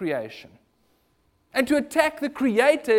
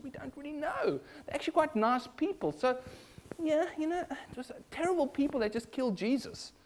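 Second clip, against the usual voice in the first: higher, softer voice of a doubter; mock hesitant doubter; 6 semitones higher, 6 dB quieter than usual. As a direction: hedge it, imitating someone unsure. Voicing doubters who soften sin.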